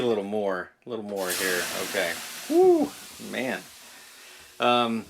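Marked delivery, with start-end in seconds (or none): 2.5-2.9 excited. Real, not acted. Exclaims in the hot steam.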